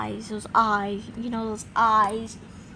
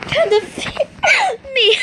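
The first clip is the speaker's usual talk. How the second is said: laughing threat; 13 semitones higher, 7 dB louder than usual. Acted.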